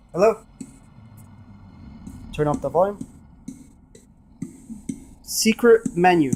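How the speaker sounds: normal talking voice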